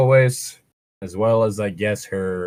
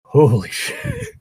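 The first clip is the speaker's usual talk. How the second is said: shocked exclamation; slower than usual. Real, not acted. Reacting to entitled commenters.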